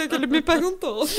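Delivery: normal talking voice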